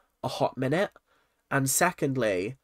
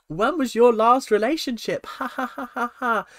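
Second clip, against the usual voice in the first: high-pitched question with put-on laugh; mock-giggly, much higher voice; imitating chirpy bride; 10 semitones higher, 4 dB louder than usual. Bride asking groom about his ex, mock chirpy.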